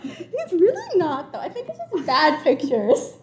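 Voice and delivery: slow, high, laughing; laughing protest. Real, not acted. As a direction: laugh while defending him. Laughing defence.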